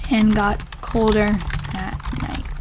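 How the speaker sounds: slow, halting read-aloud while typing; slow dictation. Real, not acted. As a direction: read it out softly in small chunks, with long pauses. Reads diary aloud while typing.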